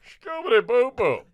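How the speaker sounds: high, slow cartoon dog voice; Scooby-Doo. Girl in a romantic moment, high slow Scooby-Doo. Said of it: "she looks into my eyes and goes".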